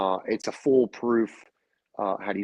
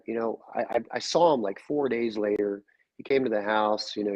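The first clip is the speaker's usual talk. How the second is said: usual speaking voice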